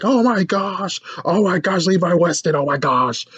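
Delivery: high-pitched mock fan gushing; starstruck fan. Fans spotting a rock star at school, starstruck. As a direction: gush like an awed fan.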